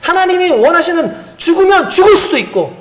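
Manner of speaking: climbing, fervent sermon voice; impassioned preaching. Acted. Pastor urges believers to trust God fully, fervent. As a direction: build toward the altar call.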